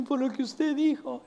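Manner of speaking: voz de niño algo más aguda; child voice. Retold or imitated. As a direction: imita suavemente a un niñito. El pastor imita al niño. (un toque de voz infantil)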